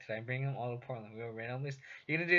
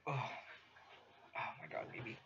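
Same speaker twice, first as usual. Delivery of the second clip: cooing whisper; 6 semitones lower, 16 dB quieter than usual. Real, not acted. Cooing at a pet.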